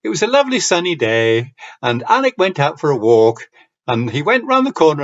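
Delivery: repetitive lilt, "went out for a walk" sliding downwards; sing-song falling inflections. Shown as a demonstration. Teacher mimicking a bad reading habit, sing-song falling inflections on "lovely sunny day". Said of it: "very sing-song delivery or one of those falling inflections".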